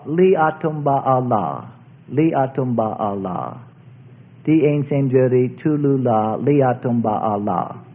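"Li Atumba Allah" spoken slowly, syllable by syllable; slow, low-pitched recital of "Li Atumba Allah"; Javanese song words. Shown as a demonstration. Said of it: "the words are".

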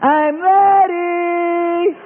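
high, slow whiny voice; slow high whining plea; impatient believer. Acted. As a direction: drag it out pleadingly.

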